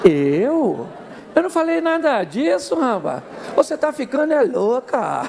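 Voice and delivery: swooping, stretched-out disbelief; exaggerated indignant denial. Husband denying what he said, mock-outraged and swooping. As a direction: deny it in an over-the-top, drawn-out way. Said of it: "Ele fala".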